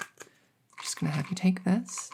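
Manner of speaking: whispered instruction to the patient. Acted. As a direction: hush it as you hand the pill over. Whispering while handing a pill.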